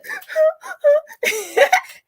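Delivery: a sudden high laugh-sob; laugh breaking into a cry. Real, not acted. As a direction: let the emotion crack out in a teary laugh. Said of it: "I get all emotional".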